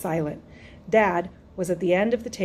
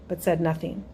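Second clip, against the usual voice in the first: usual speaking voice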